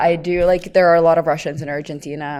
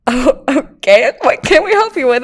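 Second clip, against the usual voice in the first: lightly voiced cashier; 8 semitones higher, 8 dB louder than usual. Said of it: "And they're like".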